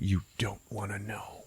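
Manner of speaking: hushed, ominous whisper; mock-traumatized breath of a whisper; haunted whisper. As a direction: whisper it like a dark secret. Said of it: "And he's like".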